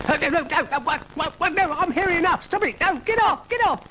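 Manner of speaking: very high, frantic squealing; panicked protest. Acted. Dougal has mixture poured on his head, frantic high protest. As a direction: squeal and splutter to fend it off.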